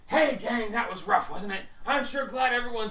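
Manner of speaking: loud, high, cheery call to the gang; upbeat arriving companion. Acted. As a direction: rush in full of loud relief. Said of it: "And he goes".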